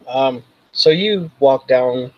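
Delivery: normal talking voice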